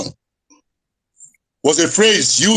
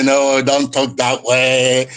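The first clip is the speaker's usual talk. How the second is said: drawn-out, wheedling mock appeaser; mockingly slow and drawn out; 2 semitones lower, much slower than usual. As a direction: imitate a slow, placating do-nothing. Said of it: "this is not time to dilly-dally".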